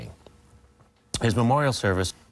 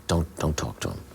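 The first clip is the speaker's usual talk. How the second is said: low hushed warning; 6 semitones lower, 4 dB quieter than usual. Retold or imitated. Same coordinator warning repeated.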